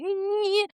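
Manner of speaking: high, drawn-out "nyeh" noise; cartoonish squeal. Teller mimics the waiter fleeing, high cartoonish squeal. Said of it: "And the guy's like".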